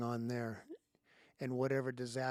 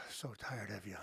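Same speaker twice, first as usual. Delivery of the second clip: whispering; 5 semitones lower, 9 dB quieter than usual. Real, not acted. Weary aside after sigh.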